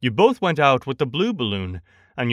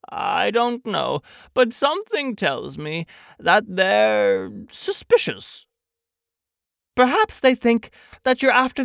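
slow, higher, pondering Pooh; uncertain storybook bear; 7 semitones higher than usual. Acted. Pooh tells Christopher Robin about suspicious bees, slow and uncertain. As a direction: puzzle it out slowly and earnestly.

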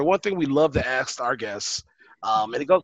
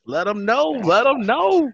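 hype shout; 7 semitones higher, 3 dB louder, slower than usual. Real, not acted. Hyping the guest's boast.

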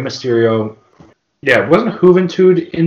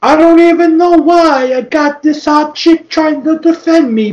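mock meathead; 12 semitones higher than usual. Playing Vito bragging about his next match, high and hammed-up. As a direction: boast like a dumb heel wrestler.

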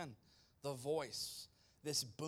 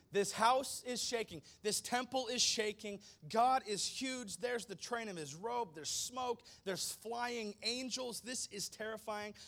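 higher, louder, breathless awe; awed excitement; 7 semitones higher, 5 dB louder than usual. Acted. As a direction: paint the terror with rising wonder. Preacher building the terror.

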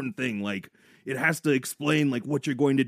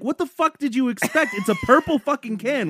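high, incredulous retelling; exasperated; 11 semitones higher, 4 dB louder than usual. Retold or imitated. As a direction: scoff at the obvious. Retold exasperated reply.

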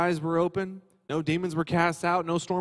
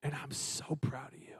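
tender whisper; 4 semitones lower, 15 dB quieter than usual. Acted. God speaking to his son.

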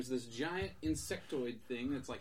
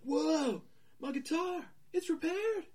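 high, louder astonished exclaim; amazed; 6 dB louder, slower than usual. Acted. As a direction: gasp in wonder at the fixed guitar. Jake amazed guitar fixed.

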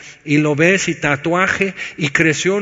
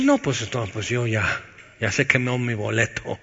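resigned listener; 4 semitones lower, 5 dB quieter than usual. Pretend. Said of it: "Entonces dices".

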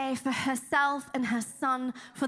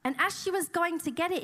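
ordinary talk, nothing marked

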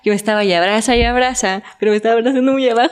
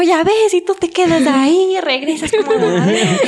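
high, raised mother telling her off; scolding mom; 7 semitones higher than usual. Retold or imitated. Mother scolds her daughter for coming home drunk, high and nagging. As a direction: nag like a fed-up mother. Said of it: "Me dijo".

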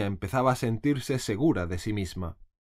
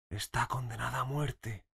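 much softer, secretive whisper; whisper in the ear; 8 dB quieter than usual. A narrator's reading. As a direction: whisper it close to her ear. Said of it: "se acercó a su oído". (susurro al oído, muy bajo)

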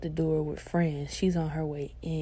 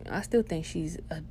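ordinary talk, nothing marked

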